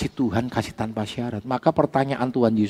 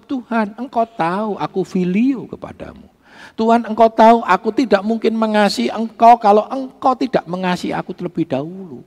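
raised, insistent voice imitating Peter; earnest retold plea; 9 semitones higher, 6 dB louder than usual. Peter answers Jesus's question about love, earnest high-pitched plea. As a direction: plead Peter's case, high and emphatic.

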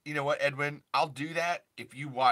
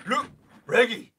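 put-on voice; 10 semitones higher, 8 dB louder than usual.

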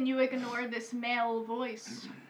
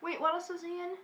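ordinary delivery

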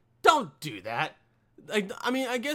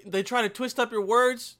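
ordinary talk

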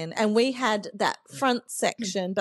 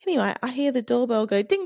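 ordinary talk, nothing marked

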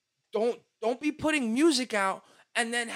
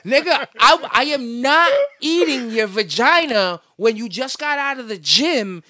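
loud, emphatic, disgusted refusal; loud disgusted rant; 4 semitones higher, 8 dB louder than usual. Real, not acted. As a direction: shout it in disgust.

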